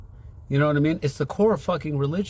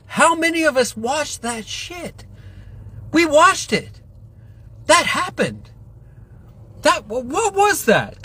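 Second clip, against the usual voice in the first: much higher, louder, slow incredulous yell; real shouting; 12 semitones higher, 4 dB louder than usual. Real, not acted. Railing at viewers about covid, furious shout. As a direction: shout it like you can't believe it happened. Rant peaks over covid.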